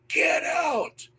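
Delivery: high, drawn-out eerie command; ghostly man's voice. Retold or imitated. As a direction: imitate a spectral warning over a walkie-talkie. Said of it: "is a man's voice".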